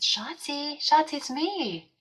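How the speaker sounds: high, slow, tender pet voice; tender call to a pet. Retold or imitated. Calling to the dying cat Shotzi over the phone, tender and slow. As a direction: coo her name gently into the phone.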